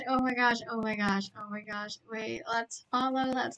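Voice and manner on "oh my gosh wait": normal talking voice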